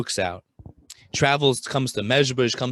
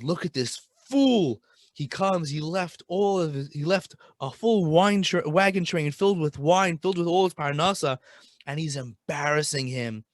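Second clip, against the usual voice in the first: scornful public rebuke; 5 semitones higher than usual. Retold or imitated. Rebbe mocking the merchant at the Friday night meal, high and scornful. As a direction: shame him before the whole table.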